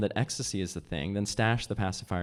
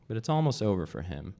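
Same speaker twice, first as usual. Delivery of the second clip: ordinary delivery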